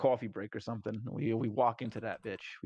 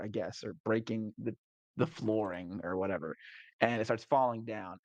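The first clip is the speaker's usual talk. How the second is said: usual speaking voice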